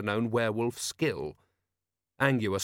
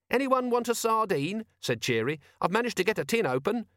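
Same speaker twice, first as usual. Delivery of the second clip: high-pitched character voice; helpful Cheery; 11 semitones higher than usual. Acted. Cheery offering food to shipmates, high helpful voice.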